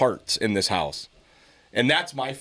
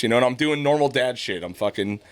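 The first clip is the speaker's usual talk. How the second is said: usual speaking voice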